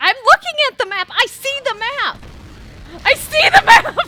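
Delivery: high, loud, fast protest; exasperated shouting. Real, not acted. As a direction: shout back that you see it. Yelling back at teammates.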